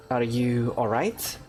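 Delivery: ordinary talk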